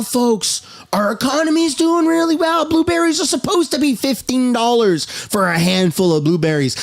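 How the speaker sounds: mock-cheerful parroting of a politician; mocking sarcasm. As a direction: sarcastically parrot the official line, pitch often jumping up.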